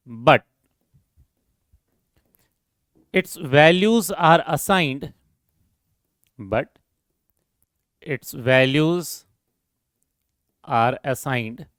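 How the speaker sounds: very slow, word-by-word dictation pace. Acted. Teacher dictating a definition to students, slow and measured. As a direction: spell out the definition so students can write it down.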